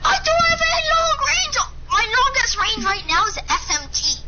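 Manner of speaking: high whining complaint; whiny wail. Pretend. Whining about weapons.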